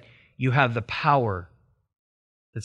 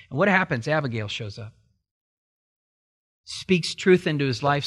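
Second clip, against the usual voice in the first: usual speaking voice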